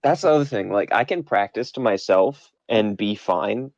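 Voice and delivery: normal talking voice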